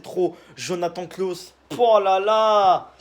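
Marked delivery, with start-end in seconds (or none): 1.8-2.9 a long, slow, loud oh là là, pitched high; excited oh là là. Real, not acted. Vlogger marvelling at Lens losing Jonathan Klaus, loud and drawn out. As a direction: stretch it out, thrilled by the signing.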